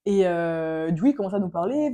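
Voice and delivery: ordinary delivery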